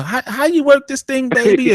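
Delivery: fake-innocent mom asking for help with a gadget; mother playing dumb. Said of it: "They play dumb".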